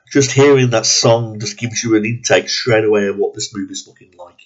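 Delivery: usual speaking voice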